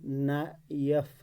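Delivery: Knife drawn out slowly, na, pause, if; split into syllables. Shown as a demonstration. Teacher showing the word has two syllables, split into syllables. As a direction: stretch knife into two separate beats. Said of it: "knife में दो".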